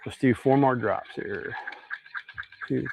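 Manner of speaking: usual speaking voice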